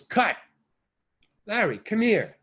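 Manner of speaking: mock director's shout; director calling cut. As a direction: summon him off the set. Director stops the take.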